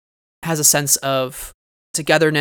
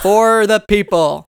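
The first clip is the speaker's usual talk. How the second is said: slow, louder and higher; hammed-up rallying cry; 6 semitones higher, 5 dB louder, slower than usual. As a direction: declare it like a grand slogan.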